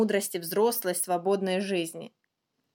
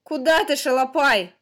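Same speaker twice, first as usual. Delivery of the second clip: louder, higher gruff challenge; somewhat rough; 5 semitones higher, 7 dB louder than usual. A narrator's reading. Older brother confronts his little brother chasing him, somewhat rough. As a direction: ask it roughly, voice raised. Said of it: "грубовато спросил я его".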